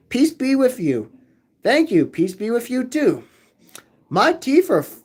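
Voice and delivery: ordinary talk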